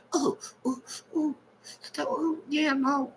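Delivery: high, soft mock moans; imitated moaning. Imitating moans overheard from the church office, high soft moaning. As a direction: moan lightly in a high imitating voice. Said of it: "you can hear some moaning".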